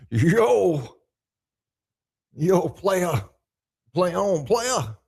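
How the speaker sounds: loud, punchy imitation of a buddy; Scotty. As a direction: call it out like your hyped-up friend. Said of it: "I read that in your voice".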